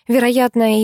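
ordinary delivery

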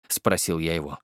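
normal talking voice

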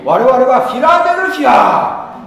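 loud, high call to the congregation; preaching shout. Real, not acted. Preacher calling out to his brothers and sisters, loud and high. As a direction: proclaim it to the whole hall. Preacher opening sermon loudly.

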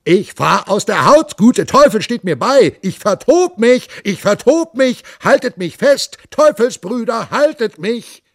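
very loud, very high possessed bellowing; wild roaring. Acted. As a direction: rave like a man gone mad. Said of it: "und brüllte".

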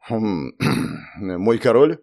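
slow, halting query after clearing the throat; hesitant deference. A narrator's reading. Captain hesitantly answers his king's dinner invitation, deferential and halting. Captain torn between order and etiquette.

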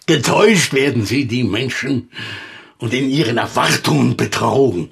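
loud, ringing recital of the quote; declaiming loudly. Acted. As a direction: proclaim it like a stage orator. Declaiming Goethe quote emphatically. (laut deklamiertes Goethe-Zitat)

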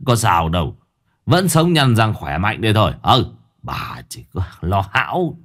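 a husband scoffing at his wife's worry; husband glaring at wife, dismissive; gruff husband dismissing. Acted. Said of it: "quay lại lườm lườm".

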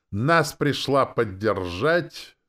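slow, loud, high MC call; shouted announcement. A narrator's reading. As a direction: announce it to the whole crowd. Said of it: "Перч прокричал". (протяжный крик конферансье)

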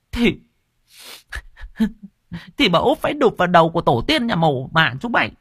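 very high, shrill-edged tirade; a frantic woman cursing the lads for her husband; high furious scolding; cursing wife. A narrator's reading. Wife curses the young men.

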